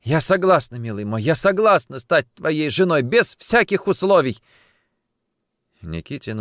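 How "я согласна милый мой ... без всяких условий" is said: high, loud joyful acceptance; elated. Acted. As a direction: burst out happily, gushing. Face brightens, accepts the proposal.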